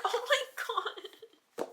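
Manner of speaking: high, slow laugh-soaked gasp; laughing disbelief. Real, not acted. Moved reader to her viewers, laughing in high disbelief. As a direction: melt into a laugh over the words. Overwhelmed by the touching passage.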